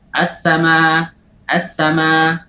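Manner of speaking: as-sama with the final hamza clearly sounded; slow clear demo; clear recitation. Shown as a demonstration. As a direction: make the hamza of as-sama heard. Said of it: "harus dijelaskan".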